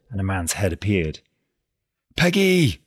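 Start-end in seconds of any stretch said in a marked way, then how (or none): 2.2-2.8 high, loud angry call; angry shout. A narrator's reading. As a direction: yell the name in anger. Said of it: "he cried angrily".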